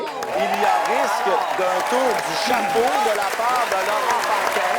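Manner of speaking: loud, rising announcer build-up; announcer hype. Acted. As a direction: sell it like a big event. Host hyping a hat trick.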